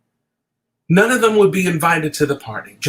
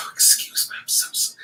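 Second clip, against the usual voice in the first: breathy, tearful apology; fighting back real tears; choked up; 5 dB quieter than usual. Real, not acted. Talking to the camera, choked up and apologising. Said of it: "I cry all the time".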